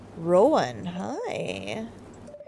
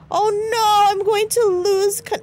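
high, loud alarm; high-pitched flustered cry; playful panic; 8 semitones higher, 5 dB louder than usual. Pretend. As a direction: wail in mock panic. Losing control of broom.

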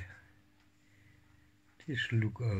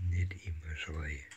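1 soft and low; 5 semitones lower, 6 dB quieter than usual. Real, not acted. Soft scolding to kitten.

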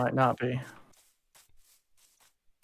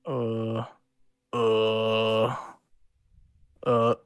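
zombie groan; 2 semitones lower, much slower than usual. Retold or imitated. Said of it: "this noise that I've been making all the time is a zombie noise".

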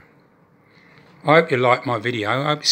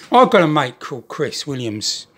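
ordinary talk, nothing marked

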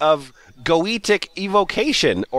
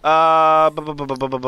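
ordinary talk

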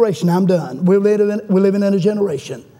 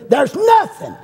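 high cry peaking on "nothing"; emphatic preaching cry; 8 semitones higher, slower than usual. Real, not acted. Preacher rousing the congregation, high emphatic cry.

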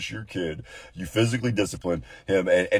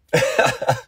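short burst of chuckles; real brief laugh at own remark; breathy little laugh; 6 semitones higher, 3 dB louder than usual. Real, not acted. Speaker laughs at own aside.

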